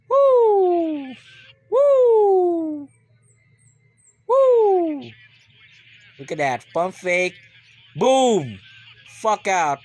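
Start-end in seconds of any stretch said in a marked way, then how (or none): 0.1-5.1 loud high whooping cheer; cheering woo. Real, not acted. Fan cheers a great play with loud high woos. 6.7-9.8 loud high hyped call; excited play call. Real, not acted. Fan calls the live play, loud and hyped.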